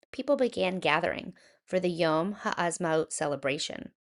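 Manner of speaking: ordinary delivery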